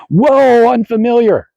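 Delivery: high, loud mock alarm; alarmed mind. Mind meeting something unfamiliar, alarmed. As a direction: balk with a big, drawn-out whoa. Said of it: "just your mind going".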